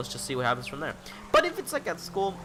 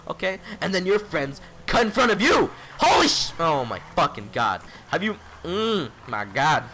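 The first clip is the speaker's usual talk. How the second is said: angry outburst; 4 semitones higher, 5 dB louder than usual. Real, not acted. Ranting about friends cutting in line.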